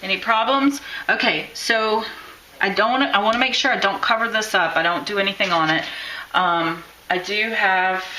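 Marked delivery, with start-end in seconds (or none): none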